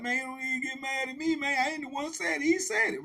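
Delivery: high-pitched, defensive pleading; imitated protest. Dude protesting he is blameless.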